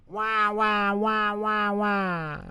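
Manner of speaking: put-on high wailing baby; baby yelling. A narrator's reading. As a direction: wail like a baby. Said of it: "the baby was yelling as loudly as possible".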